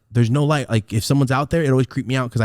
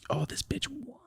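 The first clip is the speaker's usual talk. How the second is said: whisper; 10 semitones higher, 8 dB quieter, slower than usual. Retold or imitated. Secretly cursing mom in whisper.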